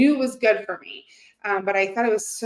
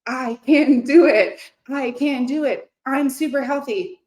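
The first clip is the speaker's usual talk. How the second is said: self-affirmation; 3 semitones higher, 3 dB louder than usual. Acted. Talking herself into liking vegetables, emphatic self-affirmation. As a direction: pump yourself up word by word. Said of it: "But it's like".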